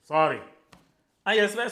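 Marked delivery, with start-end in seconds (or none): 0.0-0.4 a blunt, loud, flat sorry; curt sorry. Shown as a demonstration. The wrong way to apologize, loud curt sorry. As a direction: toss off a short, hard sorry. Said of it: "You don't say".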